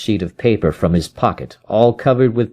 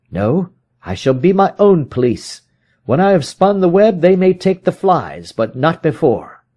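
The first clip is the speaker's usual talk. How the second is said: higher, firm detective voice; Holmes, cold determination; 8 semitones higher than usual. Acted. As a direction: spin the threat calmly.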